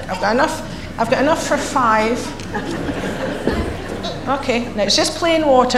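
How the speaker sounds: ordinary talk, nothing marked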